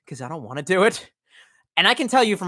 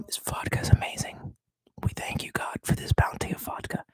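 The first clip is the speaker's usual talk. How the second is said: whispered mock prayer; 10 dB quieter than usual. Mock prayer over vodka.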